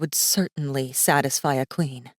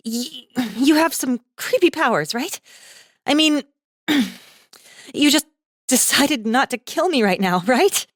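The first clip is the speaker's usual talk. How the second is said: put-on nervous, higher young woman, stammering; unsteady voice; 7 semitones higher, 3 dB louder than usual. Girl nervously asking Masaaki about his powers, unsteady and high. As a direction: fish for reassurance, voice wobbling. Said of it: "her voice unsteady".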